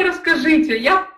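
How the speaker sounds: ordinary talk, nothing marked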